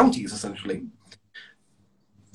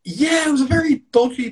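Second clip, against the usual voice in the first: excited shout, pitched up and louder; 11 semitones higher, 6 dB louder than usual. Real, not acted. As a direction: burst out with it loudly. Excited shout about pub.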